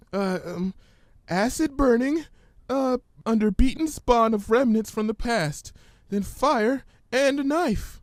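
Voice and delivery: high, hesitant frightened pony; Fluttershy's voice. Acted. Fluttershy fearfully reciting the ominous words, timid and high.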